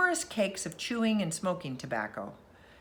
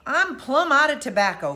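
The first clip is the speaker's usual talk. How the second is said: complaining; 6 semitones higher, 7 dB louder than usual. A narrator's reading. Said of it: "complained Uncle Salters".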